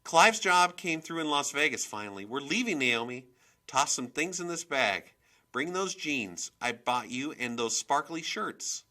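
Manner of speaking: high, loud, bossy excitement; worked-up mother hurrying her kid; urgent announcement. A narrator's reading. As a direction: rattle off orders to pack, loudly. Mother hurriedly orders daughter to pack.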